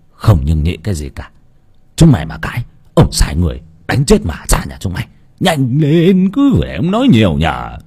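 low domineering village lord; gruff old master. Acted. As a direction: order the boys around. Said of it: "lão quát như thế".

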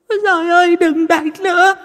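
shrieking ghost. Acted. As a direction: howl under the blows. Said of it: "mà rú lên".

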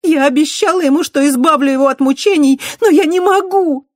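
high, loud, voice breaking into tears; tearful. A narrator's reading. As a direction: cry out through tears. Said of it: "в её голосе отчётливо звучали слёзы". (сквозь слёзы, срываясь на плач)

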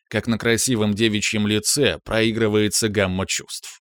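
ordinary delivery, nothing marked

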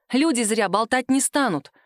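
ordinary delivery, nothing marked

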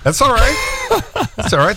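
high, bursting laugh through the words. Real, not acted. Host laughing as he reassures the guest about voicing his own ad, high and amused. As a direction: laugh warmly while reassuring.

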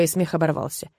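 usual speaking voice

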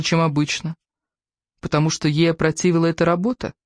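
ordinary delivery

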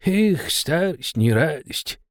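slow, lightly voiced old man mumble; grumbling old man. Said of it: "бубнил он".

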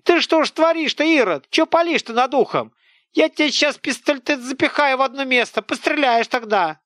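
high, loud village scold; an angry peasant woman tearing into a boy; scolding old woman. Acted. (сварливая тётка, громко и высоко)

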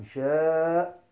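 شاء with a drawn-out, steady vowel; lengthened madd. Shown as a demonstration. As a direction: hold the long aa of شاء before the stop. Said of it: "الوقف على المد المتصل".

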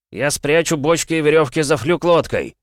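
shouting over the storm. A narrator's reading. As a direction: call it out loud over wind. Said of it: "крикнул Джоран". (громкий крик)

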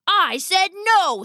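a hint of a stern father; stern refusal.

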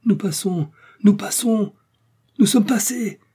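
high, mounting triumphant shouts; exultant cry. A narrator's reading. Joe announces they clear the crest, crying out. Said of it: "cria une voix qui fit bondir le cœur".

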